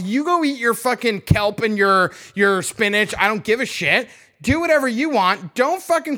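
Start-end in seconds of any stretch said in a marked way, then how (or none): none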